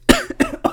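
squeaky fake cough; fake high cough.

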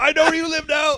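high, loud put-on threat; mock menacing voice. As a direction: threaten like a cartoon stranger.

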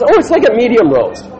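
lightly hammed sweet barista; chirpy barista. Barista describing the blonde roast, mock sweet. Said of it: "She goes".

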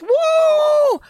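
high, loud whooping cheer; excited whoop. Real, not acted. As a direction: let out a big hype whoop. Hype cheer for moderator.

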